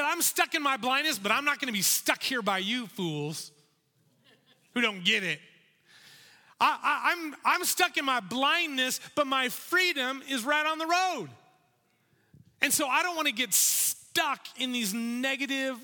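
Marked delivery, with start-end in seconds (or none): 0.0-11.3 higher, louder defiant cry of the blind man. Acted. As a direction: refuse to be held back, cry it out. Voicing Bartimaeus defying the crowd.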